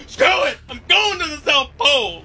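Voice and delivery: high mock shout, quitting it all; mock dumped boyfriend. Cohost mocks a heartbroken explorer, high mock shout. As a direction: squeal it like a jilted man storming off. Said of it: "made you say".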